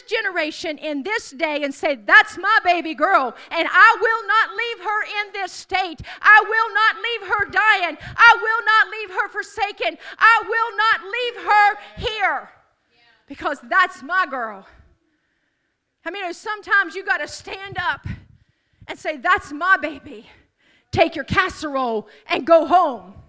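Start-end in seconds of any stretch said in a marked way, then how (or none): 1.9-14.7 loud, slow, declaring like a parent who won't let go; parent's fierce claim. Acted. A parent refusing to abandon a dying daughter, loud and slow. 19.2-23.2 loud, firm send-off; firm dismissal. Acted. A parent telling sympathizers to leave, loud and firm. Parent sending visitors away.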